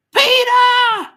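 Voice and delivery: high, loud happy call of a name; delighted yell at a newcomer to the chat; excited shout. Real, not acted. Greets another viewer arriving.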